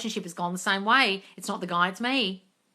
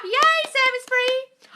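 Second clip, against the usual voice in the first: high, delighted squeal; excited squeal; 6 dB louder, slower than usual. Acted. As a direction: squeal it with joy. Reading a viewer's happy comment.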